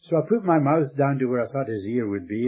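usual speaking voice

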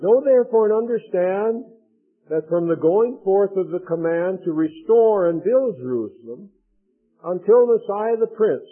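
steady, solemn Bible reading; scripture reading to a congregation. Acted. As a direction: read the angel's message with weight. Reads the seventy weeks prophecy.

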